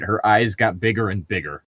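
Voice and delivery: ordinary talk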